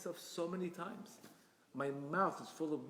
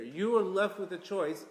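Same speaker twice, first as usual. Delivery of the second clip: ordinary talk, nothing marked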